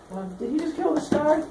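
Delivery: high-pitched student voice; hesitant high voice; questioning student. Acted. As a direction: pipe up with a puzzled question. Acted student questions teacher.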